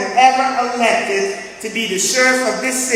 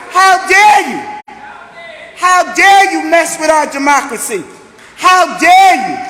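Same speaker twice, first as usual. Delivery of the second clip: angry shout; 3 semitones higher than usual. Real, not acted. Sheriff rallying the crowd against the state, furious shout. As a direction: throw it at them like an accusation. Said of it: "I'm mad".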